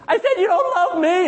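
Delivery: high, insistent whiny wail; hammed-up weepy complaint; mock tearful wife. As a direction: imitate an ignored wife wailing it out. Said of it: "she's in tears".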